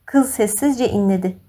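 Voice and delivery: plain reading voice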